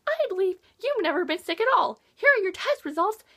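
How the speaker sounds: a hint of a doctor reading test results; doctor's news. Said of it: "she's like".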